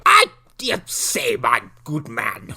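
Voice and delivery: indignant British accent, slow and hammed-up; mock British gentleman. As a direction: put on a stuffy British accent, stretching the words. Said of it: "thinks he's from Britain".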